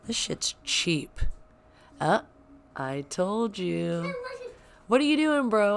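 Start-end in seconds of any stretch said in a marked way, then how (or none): 4.9-5.8 calling out. Real, not acted. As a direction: call up to him, half laughing. Calling out to son upstairs.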